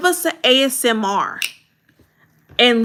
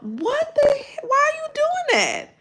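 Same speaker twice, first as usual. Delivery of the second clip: far higher, stunned and incredulous; what are you doing, far higher pitch; baffled disbelief. Acted. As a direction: react like a friend hearing nonsense. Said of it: "That's how I would look at you".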